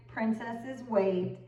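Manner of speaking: plain reading voice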